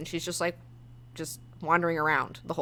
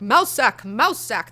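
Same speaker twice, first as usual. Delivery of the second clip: characters calling the name; 4 semitones higher, 5 dB louder than usual. Retold or imitated. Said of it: "every time they're like".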